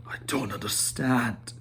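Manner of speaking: hushed, puzzled whisper; whispered bewilderment. Acted. Thorin to the wizard, whispering in confusion. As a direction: breathe it out in confusion.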